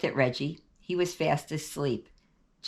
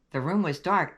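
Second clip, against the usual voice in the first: plain reading voice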